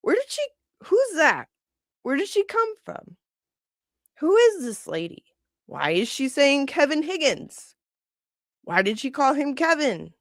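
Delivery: high, puzzled fan chorus; confused viewers. Retold or imitated. Viewers collectively puzzled by a stranger, high confused voice. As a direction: squeak out the bafflement of fans. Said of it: "all collectively going".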